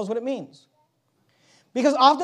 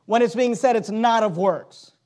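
usual speaking voice

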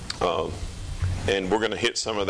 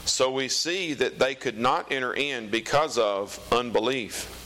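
raised, slow solemn Bible reading; scripture reading; 5 semitones higher than usual. Acted. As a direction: read it out like the pulpit. Reads scripture aloud.

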